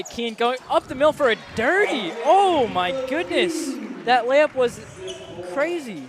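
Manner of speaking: slow, loud amazed call; awed commentator call; excited. Real, not acted. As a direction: marvel at the play, drawn out.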